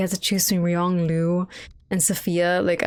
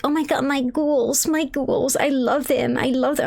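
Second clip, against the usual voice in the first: mock gushing, pitched up high; Sophia/character; 6 semitones higher than usual. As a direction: imitate a woman fawning over her darlings. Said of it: "when she started being like".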